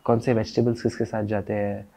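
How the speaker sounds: ordinary talk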